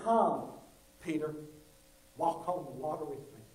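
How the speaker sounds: hushed, tender call to follow; gentle Jesus. Retold or imitated. Jesus invites Peter.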